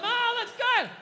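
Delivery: husband faking delight to his wife; fake praise. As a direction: gush to sell the lie. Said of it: "you lie and you tell him".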